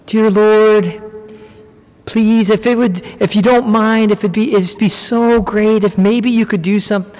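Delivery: overly polite, hedging prayer voice; a person politely tiptoeing in prayer, mock timid; polished timid prayer. Mock meek, over-polite asking. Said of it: "it looks something like this".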